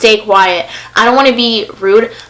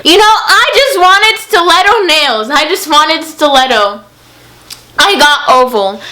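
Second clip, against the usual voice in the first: whiny complaint; 5 semitones higher than usual. Real, not acted. Whiny complaint about nails.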